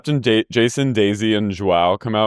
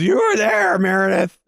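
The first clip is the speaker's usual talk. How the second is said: much higher, squeaky accusing imitation; mock Heather; 10 semitones higher than usual. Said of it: "And Heather goes".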